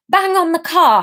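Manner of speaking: high, loud urgent cry; screamed thought. A narrator's reading. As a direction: yell it out as a panicked order. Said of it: "her brain screamed".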